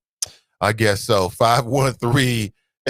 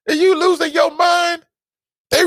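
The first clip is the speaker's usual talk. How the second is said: high incredulous yell; 14 semitones higher, 4 dB louder than usual. Real, not acted. Host yelling high at a believer, incredulous.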